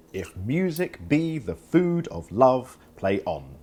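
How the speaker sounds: slow, measured "if MUsic BE the FOOD of LOVE, play ON"; iambic stress. Shown as a demonstration. Teacher marking Shakespeare's meter, slow weak-strong "if MUsic BE the FOOD of LOVE, play ON". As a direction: lean hard on MU, BE, FOOD, LOVE, ON. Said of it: "A curve for unstressed syllables".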